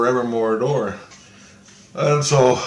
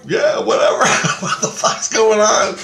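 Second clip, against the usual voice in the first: exasperated; 10 semitones higher, 5 dB louder than usual. Retold or imitated. Retold exasperated reply to praise.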